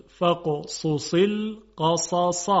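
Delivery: فقصص القصص very slowly, every sad heavy; slow careful sad. Shown as a demonstration. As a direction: spell out the four heavy sads of فقصص القصص. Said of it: "چهار تا صاد وجود داشت که به خوبی تلفظ شد". (فقصص القصص آهسته با صادهای درشت)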